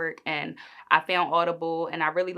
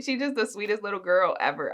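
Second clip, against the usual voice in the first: ordinary delivery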